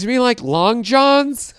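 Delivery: high, drawn-out mocking question; imitated store clerk. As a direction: stretch it slowly, loud and high. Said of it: "they all talk like this".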